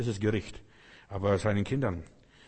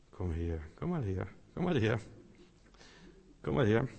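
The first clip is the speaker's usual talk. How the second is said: softer, tender calling; gentle, lovely call; 2 semitones lower, 5 dB quieter than usual. Retold or imitated. Preacher voicing God calling his child gently. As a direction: coax them in kindly and softly. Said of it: "lieblich und wohltuend".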